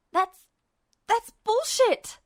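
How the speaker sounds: high, loud outburst with a stammer; shouting. A narrator's reading. Captive Ed shouting down the villain's threat. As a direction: hurl it back at him. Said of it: "Ed shouted".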